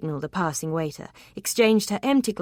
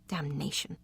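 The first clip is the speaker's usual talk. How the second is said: soft inner curse; muttered curse; 2 semitones lower, 9 dB quieter than usual. A narrator's reading. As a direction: curse under the breath.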